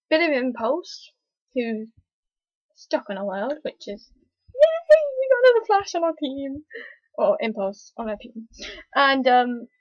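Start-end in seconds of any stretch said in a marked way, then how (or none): 4.5-6.6 high, loud gleeful yay; excited cheer. Real, not acted. Fan cheering a new Flash on the team, high and loud.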